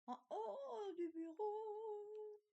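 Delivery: stretched high "En haut du bureau"; drawn out on a high note, singsong; high, slow. Shown as a demonstration. Placing a decoration on her desk, slow and high.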